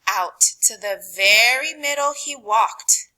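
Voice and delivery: ordinary delivery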